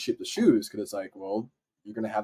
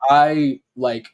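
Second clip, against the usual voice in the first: usual speaking voice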